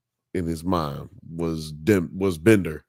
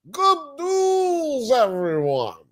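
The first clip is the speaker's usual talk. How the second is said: Professor catchphrase; slower than usual. Retold or imitated. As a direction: call it out like the cartoon professor.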